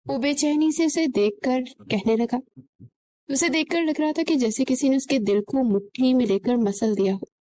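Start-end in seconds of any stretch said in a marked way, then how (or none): none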